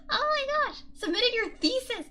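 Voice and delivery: high, loud delighted praise; excited cheer. Real, not acted. Streamer cheering a viewer's thesis, excited. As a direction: celebrate it out loud. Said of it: "I get really excited".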